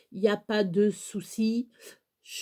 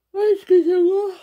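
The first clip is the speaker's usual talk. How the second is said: sudden high gasp, then a loud startled apology; real startled gasp and loud excuse me; 9 semitones higher, 9 dB louder than usual. Real, not acted. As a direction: caught off guard, gasp and blurt out sorry. Startled mid-talk, apologizing.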